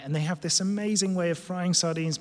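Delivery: ordinary talk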